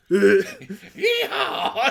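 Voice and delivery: high, loud whooping yeehaw; cowboy yell. Acted. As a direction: let out a big rodeo holler. Voicing reckless cowboy crash landing.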